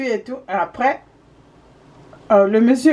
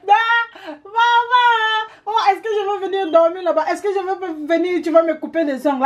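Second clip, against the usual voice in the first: mocking the visitor; 8 semitones higher than usual.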